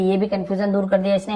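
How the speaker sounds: usual speaking voice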